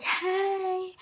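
high-pitched, drawn-out hey. Retold or imitated. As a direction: burst in with a high greeting. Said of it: "threw open his door and I was like".

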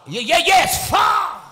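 loud excited call of yes, Father; shouted response. Real, not acted. Preacher urging the church, member shouts back loudly.

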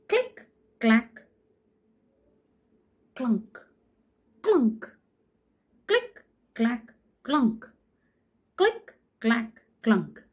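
high, rhythmic click clack clunk; stick walking sounds. Shown as a demonstration. Teacher showing the class a stick walking, rhythmic clicks. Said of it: "That's the way the stick walks".

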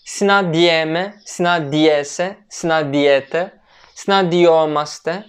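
each form said slowly, the final syllable leaned on; slow stress on endings. Shown as a demonstration. Teacher drilling Greek verb endings for students, slow and stressed. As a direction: spell out the conjugation slowly, hitting the ending. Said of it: "Vurgu hep sonda".

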